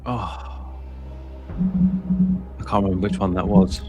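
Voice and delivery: ordinary delivery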